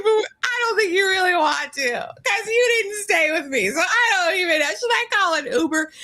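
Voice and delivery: high tearful whimper; mock crying. Host imitating Elise doubting Joshua, mock tearful whine. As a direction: whimper it with a pout. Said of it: "She's like".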